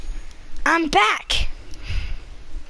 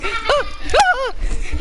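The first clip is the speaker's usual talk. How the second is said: high gasp; 11 semitones higher than usual. Real, not acted. Shocked in game.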